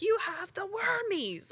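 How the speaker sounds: high squeaky greedy chicken voice. Retold or imitated. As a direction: squeak it like a hungry hen demanding treats. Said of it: "and they're like".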